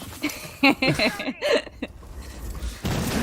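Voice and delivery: a breathy, high cackling laugh; evil laugh. Real, not acted. Caster cackling at the observer's joke. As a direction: cackle like a little villain. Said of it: "That was a little bit of an evil laugh".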